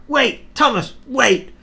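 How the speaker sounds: very high, loud pleading cry; squealing trucks. Acted. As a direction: trucks beg the engine to wait. Trucks calling after Thomas.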